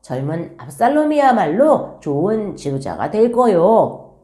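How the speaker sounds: lightly voiced henchman stirring people up; rumour-spreading henchmen. Absalom's men spreading word against David, mildly acted rumour-mongering. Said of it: "소문을 내면서 다녔을 거예요".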